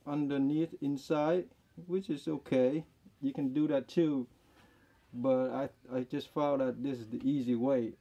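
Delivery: speaking behind surgical mask; through a face mask. Real, not acted. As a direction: mask over mouth. Seen 3: Surgical mask covers mouth and nose in every frame while speaking.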